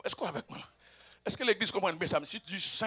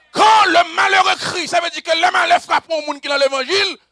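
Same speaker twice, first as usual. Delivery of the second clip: full-force preaching; 11 semitones higher, 16 dB louder than usual. Acted. Preacher promising the congregation God hears their cries, full-force preaching. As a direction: preach it in rising, repeated cries.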